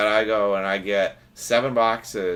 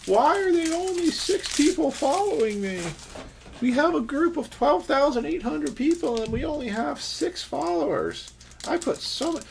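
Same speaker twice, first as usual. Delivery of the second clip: put-on much higher, whiny crying-girlfriend voice; mock sob it out, pitched way up; imitating Lisa crying. To viewers, mocking Lisa's late-night follower complaint, high whiny voice. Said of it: "she cries at night going".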